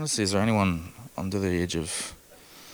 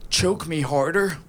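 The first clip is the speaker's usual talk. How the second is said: higher, slow, pleading mock request; desperate mock plea; 7 semitones higher, slower than usual. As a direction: beg it in a strained high voice. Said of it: "I just go".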